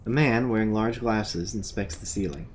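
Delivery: low, even game narrator voice; scene narration. A narrator's reading. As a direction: describe the scene like a game master.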